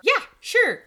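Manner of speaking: put-on high, loud, chirpy kid reply; eager child. Oldest child bribed to run to kitchen, eager high reply. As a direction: imitate an overeager kid saying yes.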